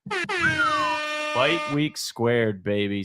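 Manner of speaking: hype announcer. Acted. Hyping the fight week event.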